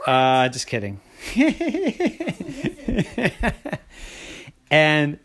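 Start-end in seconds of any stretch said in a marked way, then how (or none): 1.2-3.8 high rapid giggle. Real, not acted. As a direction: giggle at your own joke. Giggles at own joke.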